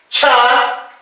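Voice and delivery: "cha" with a puff of air after the ch; aspirated consonant. Shown as a demonstration. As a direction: breathe out through the ch of "cha". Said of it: "consonantes aspiradas". (consonante aspirada, "cha" con soplo)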